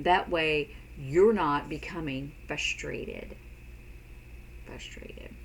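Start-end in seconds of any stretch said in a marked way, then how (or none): 4.6-5.3 breathy whispered word; a soft whispered aside. Shown as a demonstration. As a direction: whisper it under your breath. Whispered repeat of frustrated.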